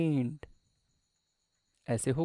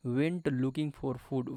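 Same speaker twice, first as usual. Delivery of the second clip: slow reading; much slower than usual. Shown as a demonstration. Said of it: "Went मतलब क्या है?".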